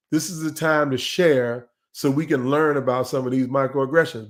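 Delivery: usual speaking voice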